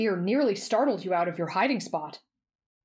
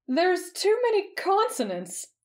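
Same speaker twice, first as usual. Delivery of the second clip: high, slow whine; comic complaint; 11 semitones higher, slower than usual. A narrator's reading. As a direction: protest in a drawn-out, very high voice. Heroine complains about foreign spelling.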